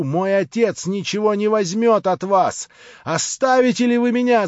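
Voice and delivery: high, loud outraged yell; furious shout. A narrator's reading. Natasha screaming at the prince about her father. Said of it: "закричала ещё раз Наташа".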